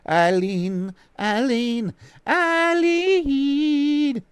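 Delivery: rising sing-song name call. Acted. Calling the name again, rising.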